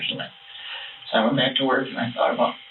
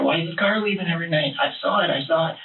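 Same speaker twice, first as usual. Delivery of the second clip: a touch of a yell, pitched up; retold yelling; 6 semitones higher than usual. Husband accuses wife in car, mildly mock yelling. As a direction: replay the accusation heated. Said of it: "I was yelling at her".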